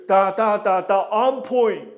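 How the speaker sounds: loud rhythmic calling, rising at the end; loud counting. Shown as a demonstration. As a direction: shout the counts over the music. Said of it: "coach calls counts over music".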